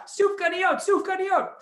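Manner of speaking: high, loud excited chant; eager high request. Acted.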